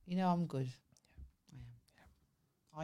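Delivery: quiet whispered boast; whispering. Real, not acted. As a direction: whisper it smugly.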